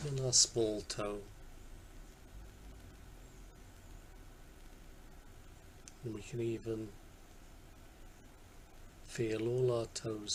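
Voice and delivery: calm body-scan guidance, long silences between phrases; guided meditation. Acted. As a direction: guide attention gently from toe to toe, unhurried.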